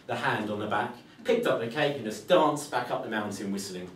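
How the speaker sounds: usual speaking voice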